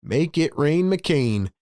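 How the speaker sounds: ordinary talk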